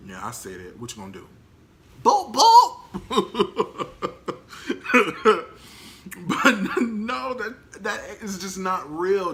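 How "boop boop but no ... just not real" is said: high, loud, laughing through the words. Real, not acted. Teasing viewers after calling love fake, real laughter. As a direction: crack up at your own boldness.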